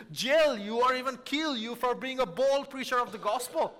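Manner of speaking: usual speaking voice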